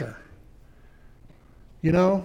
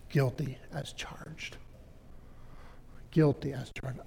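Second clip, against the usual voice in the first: quiet admission; 4 semitones lower, 11 dB quieter than usual. Real, not acted. Confession to audience.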